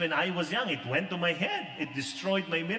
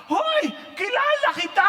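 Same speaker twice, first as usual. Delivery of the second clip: high, loud excited call; old woman calling out loud in delight; excited shout; 9 dB louder than usual. Retold or imitated. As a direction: yell across the restaurant like a thrilled grandma.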